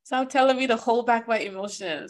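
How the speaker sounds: half-laughing, sick of being told to hold back; exasperated plea. Retold or imitated. Exasperated demand to others.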